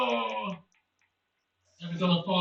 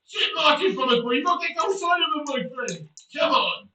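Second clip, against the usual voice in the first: loud, high-pitched team rant; exasperated shouting; 5 semitones higher, 6 dB louder than usual. Real, not acted. Player scolding team over shit marking, exasperated shout. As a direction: berate your defenders, really loud. Ranting at team after conceding.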